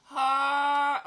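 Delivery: shrieking yell of joy; scream. Pretend. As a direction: let out a big scream. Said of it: "I did my scream".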